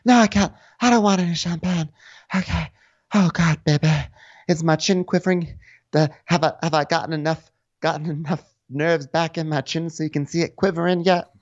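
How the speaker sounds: hammed-up dramatic high voice; Jennifer. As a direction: play the mock-fragile drama queen, pitched high. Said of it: "being all dramatic".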